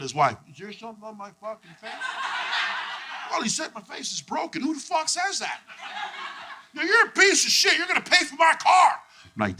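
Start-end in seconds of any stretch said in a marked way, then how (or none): 0.5-5.6 high, incredulous comeback; wounded, high-pitched indignation; offended retort. Acted. Comic acting out a fight. 6.7-9.0 put-on high, loud accented tirade; outraged yell, pitched way up; angry threat. Acted argument over a car.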